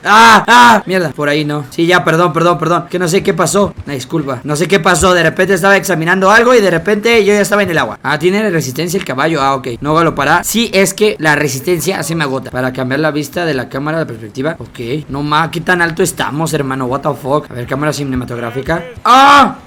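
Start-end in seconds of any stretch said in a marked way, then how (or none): 0.1-0.8 sharp loud startled cries; two short shocked shouts; startled yelps. Real, not acted. Startled by falling in water. 19.0-19.6 high loud startled scream; real startled shriek; scream of fright. Real, not acted. Sudden scare in the game.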